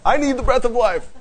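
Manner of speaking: high-pitched, playful plea for air; hammed-up breathless panic; mock desperation. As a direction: beg as if out of air, jokingly. Mimes being without air, scary.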